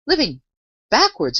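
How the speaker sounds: astonished. A narrator's reading. Alice repeats the Queen's words, greatly astonished. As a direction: echo it in disbelief. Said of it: "repeated in great astonishment".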